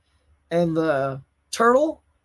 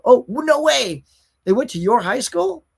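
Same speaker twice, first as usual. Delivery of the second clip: amazed; 7 semitones higher, 5 dB louder than usual. Real, not acted. Amazed at a viewer's news.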